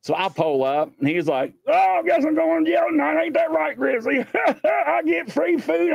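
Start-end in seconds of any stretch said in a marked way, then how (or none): 1.7-4.2 hammed-up high cheerful whine; panhandler's mock glee. Panhandler greets officer, mock glee. 4.6-5.9 high, slow, gleeful imitation; hammed-up delight at a freebie; panhandler's mock glee. Panhandler pleased about going to jail.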